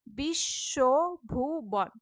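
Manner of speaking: slow, deliberate splitting into syllables; slow teacher's syllable split. Shown as a demonstration. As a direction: spell the syllables out slowly. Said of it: "যেখানে হসন্ত আছে এগুলো রুদ্ধ দল".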